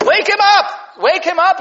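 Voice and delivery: high loud emphatic command; emphatic urging. Real, not acted. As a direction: push them to go wake him now.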